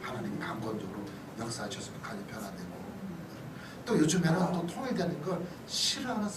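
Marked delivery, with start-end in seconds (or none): none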